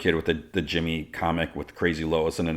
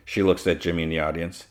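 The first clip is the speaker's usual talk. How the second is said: ordinary delivery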